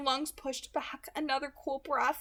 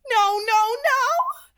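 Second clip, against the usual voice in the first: a much higher, much louder cry of anguish; agonized howl; 12 semitones higher, 12 dB louder, much slower than usual. Acted. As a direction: howl it out in agony. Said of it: "Someone howled in agony".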